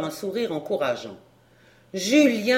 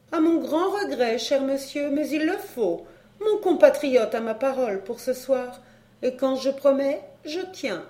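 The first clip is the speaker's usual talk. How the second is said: higher-pitched, polite lady; courteous regrets in a higher lady's voice; foreign countess; 5 semitones higher than usual. Acted.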